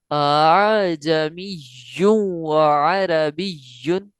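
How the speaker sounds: अअजमियुन व अरबियुन slow, voice waving on the softened hamza; tasheel recitation. Shown as a demonstration. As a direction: let the voice ripple between hamzas in अअजमियुन. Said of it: "कैसे?". (तसहील के साथ लहराती आवाज़ में)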